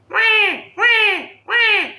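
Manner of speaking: high shrill imitated baby cry; high mock baby crying; baby wail. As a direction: mimic a wailing baby.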